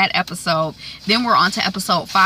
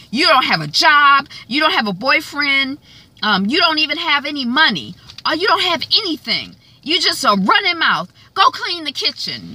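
mock scolding mom; 7 semitones higher than usual. Mother scolding the sister.

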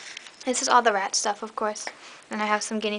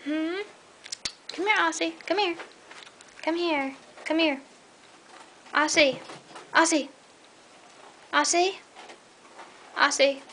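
higher, repeated calls to a pet; calling a pet; 6 semitones higher than usual. Real, not acted. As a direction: coax the pet over by calling its name. Calling her restless pet over.

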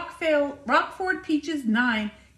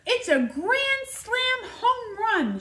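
high, slow stretched announcer call; drawn-out, high grand slam call; announcer's call; 10 semitones higher, slower than usual. A narrator's reading. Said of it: "shouted the announcer".

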